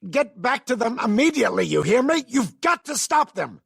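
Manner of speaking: very high, loud, frantic command; urgent furious order. Acted. Demanding they get back to them immediately, furious urgency. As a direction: shout the order at full urgency.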